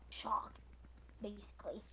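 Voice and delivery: hushed whisper. Real, not acted. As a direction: whisper it like a secret. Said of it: "I'm just gonna say I'm".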